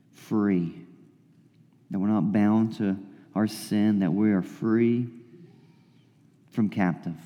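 hushed, fading prayer voice; soft prayer. Acted. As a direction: pray it softly, letting it trail away. Prays over the passage.

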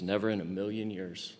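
quiet emphatic refusal. Acted. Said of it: "what he's saying here in the original language".

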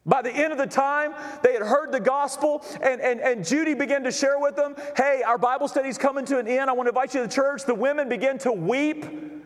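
high, ringing sermon delivery; full-force preaching. Real, not acted. Pastor telling the congregation about her full attendance, full-force preaching. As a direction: build the testimony at an urgent, high pitch.

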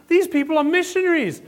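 high, loud gushing announcement with a laugh; mock excited shout. Lady in the shoe line tells everyone about missionaries, loud excited shout. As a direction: play an overexcited shopper telling the whole line. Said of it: "starts shouting it to the other people".

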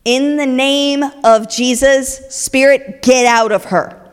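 loud, slow command; firm loud rebuke of a spirit; commanding exorcism. Retold or imitated. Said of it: "And he says".

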